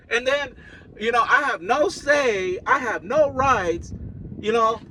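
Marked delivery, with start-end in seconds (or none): none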